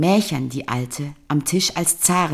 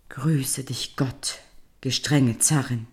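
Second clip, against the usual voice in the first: slow, hushed, timid greeting; old man whispering in fear to his wife; frightened whisper; 3 semitones lower, 5 dB quieter, slower than usual. Acted. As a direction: barely dare to speak to the tsarina. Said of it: "erschrak er".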